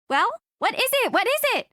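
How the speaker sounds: high, quick, eager questioning; urgent excitement. Acted. Friend on the phone, eager to hear the news. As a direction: press for the answer right now.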